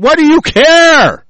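high, loud, drawn-out outburst; angry yell. Real, not acted. Speaker breaks off reading the report to object, angry yell. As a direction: burst out yelling, stretching the words.

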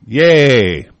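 loud, drawn-out cheer falling away; gleeful cheer. Real, not acted. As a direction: cheer it loudly, stretching the word. Cheers the blowing up.

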